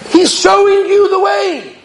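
exasperated exclamation. Real, not acted. Preacher exclaims at stubborn man.

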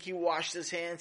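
ordinary delivery

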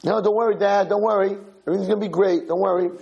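high-pitched, chirpy reassurance; confident eager kid. Acted. Kid promising Dad he will do great, eager. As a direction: brush off Dad's worry in a kid's voice.

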